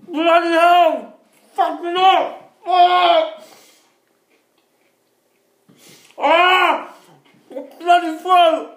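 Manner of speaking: loud, high cries of burning pain; pained yelling. Real, not acted. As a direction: yell as the heat hits. Mouth burning from hot crisps.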